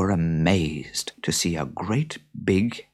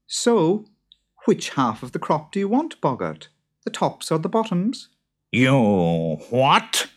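much higher, lighter wife voice; higher-voiced wife with a plan; clever wife; 9 semitones higher, 3 dB louder than usual. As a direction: propose the deal brightly. Beth questioning boggart.

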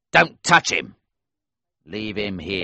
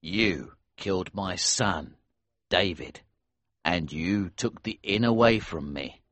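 calm, cold menace; 4 semitones lower, 4 dB quieter than usual. A narrator's reading. Smuggler faces his son's killer, calm and cold. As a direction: pass sentence coldly, without raising the voice. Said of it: "He spoke calmly and coldly".

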